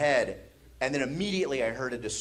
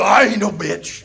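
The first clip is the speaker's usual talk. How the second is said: loud, high, drawn-out brag; slow, loud mock bravado; defiant shout; 6 semitones higher, 11 dB louder than usual. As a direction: shout back as a defiant kid brother. Brother's defiant reply, acted.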